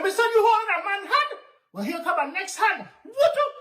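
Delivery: high, loud taunting voice; cocky challenge. Acted. Nancy challenging the tar baby, high cocky taunt. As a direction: dare him to take another hit.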